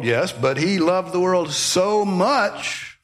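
slow, drawn out, leaning hard on much; slow emphatic stress on much. Shown as a demonstration. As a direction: stretch out so much with weight. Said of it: "it's very emphatic in this verse".

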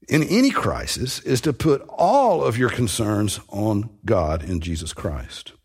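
ordinary delivery

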